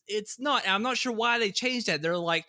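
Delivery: normal talking voice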